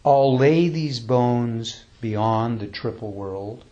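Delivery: slow, measured recitation with long pauses; slow, reverent poem recital; poem reading. Acted. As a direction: read it like a sacred verse, unhurried.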